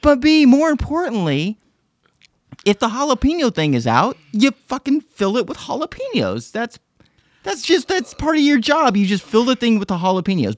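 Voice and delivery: high, loud exasperated rant; indignant rant. Real, not acted. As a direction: vent about the lazy clerk. Indignant rant about employee.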